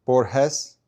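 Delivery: Borges with an h sound, bor-HESS, questioning; Borges with breathy h for g, as a question; trying a pronunciation. Shown as a demonstration. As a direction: say Borges Spanish-style as bor-HESS, rising.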